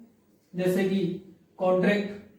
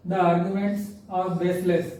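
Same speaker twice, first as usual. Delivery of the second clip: slow dictation; much slower than usual. Shown as a demonstration. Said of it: "teacher reads example slowly".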